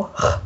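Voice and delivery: "h" as a rasped sound at the uvula; uvular fricative. Shown as a demonstration. Teacher isolates the French r, rasping "h" at the uvula. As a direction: scrape "h" at the back of the throat. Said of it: "小舌颤音这个啊".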